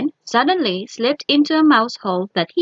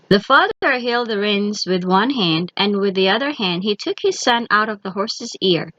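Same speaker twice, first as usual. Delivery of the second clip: usual speaking voice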